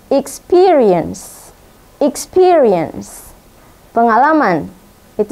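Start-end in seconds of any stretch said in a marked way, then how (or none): 0.1-3.3 Experience drawn out slowly, ik-SPI-ri-ens, each syllable clear; careful pronunciation. Shown as a demonstration. Teacher modelling the word for pupils, slow and careful. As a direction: say Experience slowly for the class to copy. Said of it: "how to pronounce those words well".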